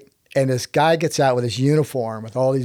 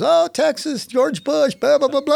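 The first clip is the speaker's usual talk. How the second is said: high-pitched, lightly mimicked colonel; hint of a Kenyan officer, pitched way up; mock colonel chattering up high; imitated British-accented colonel; 13 semitones higher than usual. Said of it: "It's a British accent".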